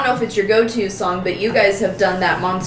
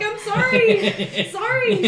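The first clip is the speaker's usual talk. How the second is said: much higher, slow, laughing "I'm sorry"; mock squeaky apology; 13 semitones higher, much slower than usual. Guest apologizing meekly to the hosts, high and laughing. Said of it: "She's like".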